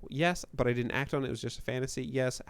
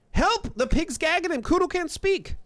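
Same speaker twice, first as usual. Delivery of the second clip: high squealed cry for help; high, raised mock-panicked yell; yelling boy; 4 dB louder than usual. A narrator's reading. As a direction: raise the alarm in a shrill kid voice. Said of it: "yelling at the windows".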